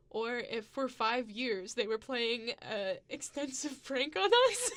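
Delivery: climbs to a high, loud laugh; amused at a five-year prank, building to high loud laughter; rising amused disbelief. Real, not acted. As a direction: build from slow to a burst of giggles. Laughing at absurd theory.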